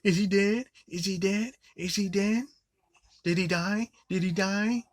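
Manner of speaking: high, shrill-pitched mock panic; mock panicked yelling. Reviewer mocking the girl's panicked line, high mock yell. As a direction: squeal it like a ditzy teen.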